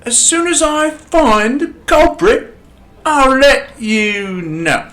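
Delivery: slow, booming reassurance; loud and slow. Acted. Constable promising Scrooge to find the culprit, slow loud reassurance. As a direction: drag it out loudly to calm him.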